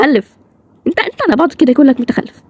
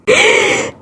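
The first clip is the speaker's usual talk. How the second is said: sharp gasp. Acted. Sudden realization mid-argument.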